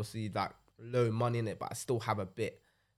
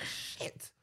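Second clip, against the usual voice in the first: whispered curse; 10 dB quieter than usual. Retold or imitated. Retold curse on seeing prices.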